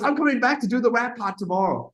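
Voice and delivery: high-pitched, excited imitation; mock cocky announcement. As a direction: announce it like an overeager star. Bobby announcing as he leaves.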